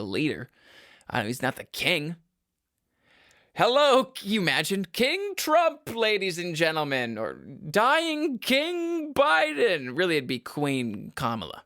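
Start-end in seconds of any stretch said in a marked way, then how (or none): 3.5-9.9 high, drawn-out mock proclamation; mock royal announcer. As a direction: announce absurd kings with loud fanfare. Mock announcing of American kings.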